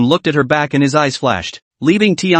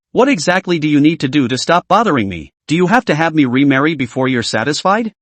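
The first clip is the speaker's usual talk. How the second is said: ordinary delivery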